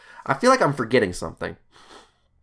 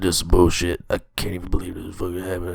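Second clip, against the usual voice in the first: grumbling; 5 semitones lower, faster than usual. Retold or imitated. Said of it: "He starts mumbling".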